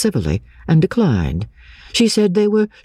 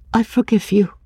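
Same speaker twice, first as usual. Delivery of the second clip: gentle forgiveness; 10 semitones higher than usual. A narrator's reading. Anne forgives the narrator.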